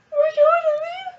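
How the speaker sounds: high sobbing shriek; crying with joy. Retold or imitated. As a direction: scream it through happy tears. Sister crying out the prize.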